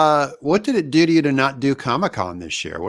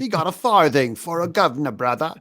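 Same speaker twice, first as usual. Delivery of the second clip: hammed-up Cockney accent, a bit higher; bad Cockney accent; 3 semitones higher than usual. Road to Perdition gag in a bad Cockney accent. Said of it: "really bad Cockney accents".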